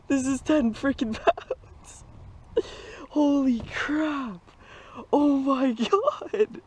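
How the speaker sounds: high squealing excitement, words stretched out; ecstatic. Real, not acted. Angler landing his personal best bass, ecstatic. Lands biggest bass ever.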